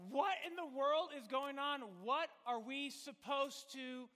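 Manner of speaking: loud, high panicked cry; panicked crowd. Acted. Preacher voicing a panicked Pentecost crowd, loud and frantic. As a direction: shout it like a crowd in chaos. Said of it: "everyone's freaking out".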